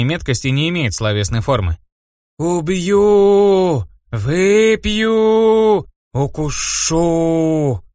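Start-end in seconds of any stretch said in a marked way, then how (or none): 2.4-7.8 sobbing ghost voice. Acted. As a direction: howl each word like a moaning spirit. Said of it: "Завыли, застонали призраки".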